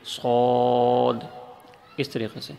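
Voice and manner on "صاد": صاد drawn out long and heavy; model Arabic letter. Shown as a demonstration. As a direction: hold صاد slowly with a full round mouth. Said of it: "اس طرح انشاءاللہ یہ مکمل آواز نکلے گی".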